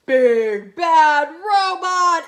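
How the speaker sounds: slow, loud, drawn out; big slow storybook reveal; dramatic reveal. A narrator's reading. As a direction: announce the villain with slow weight.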